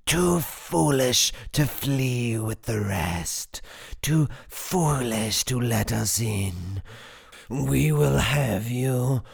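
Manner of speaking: slow, stretched-out monstrous howl; menacing, drawn-out creature howl. A narrator's reading. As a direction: wail each word long, sinking at the end. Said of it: "it howls into his face".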